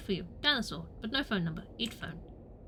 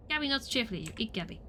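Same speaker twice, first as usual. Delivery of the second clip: normal talking voice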